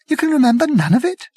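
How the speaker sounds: high, fast disbelief; can't believe it; incredulous. A narrator's reading. Arthur to Prack, high and incredulous.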